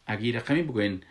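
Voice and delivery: normal talking voice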